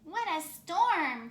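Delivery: high, loud, slow bird voice; twittering wren. Acted. Wren arriving in the bear's den, chirping high and loud. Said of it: "twitters wren".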